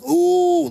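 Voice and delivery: high, loud wincing ooh; retold wince. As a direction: squeal an ooh like you felt it. Retold wincing at baby salted.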